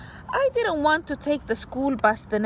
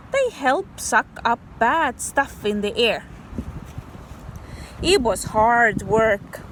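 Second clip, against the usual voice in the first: plain reading voice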